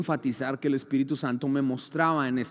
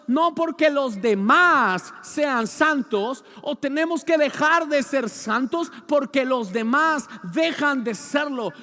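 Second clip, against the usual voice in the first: loud, slow preaching; forceful preaching; 9 semitones higher, 7 dB louder, slower than usual. Acted. Preacher exhorting the church, slow and loud. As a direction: drive each word home to the congregation.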